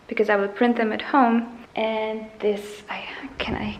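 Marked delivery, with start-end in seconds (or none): 1.8-3.8 hesitant whispered start; whispering. Real, not acted. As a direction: whisper it to yourself.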